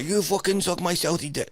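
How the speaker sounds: high, hammed-up Southie tough guy; badly faked Boston accent, yelled high. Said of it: "I can't even do a Boston accent".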